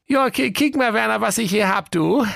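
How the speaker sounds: a chirpy excited wife; Waltraud's voice. Acted. Wife showing her husband a bundle, high and eager.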